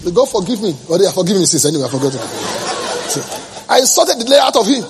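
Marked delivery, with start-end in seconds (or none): none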